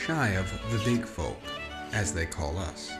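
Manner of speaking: ordinary delivery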